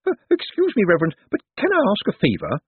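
very high, hesitant lady; bashful nervousness. A narrator's reading. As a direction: ask shyly, high and flustered. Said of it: "she said, going pink".

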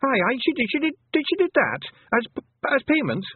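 high, loud little Scots pixie, caught out; a cheeky pixie squirming for an excuse; squeaky, guilty Feegle stalling; flustered Feegle. Acted. Rob Anybody stammers excuses.

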